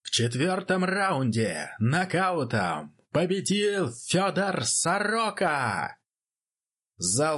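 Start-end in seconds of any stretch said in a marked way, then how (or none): 0.0-6.0 high, slow, drawn-out announcer call; ring announcer. Acted. Announcer proclaiming the knockout winner to the crowd, booming. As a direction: stretch out the winner's name for the hall.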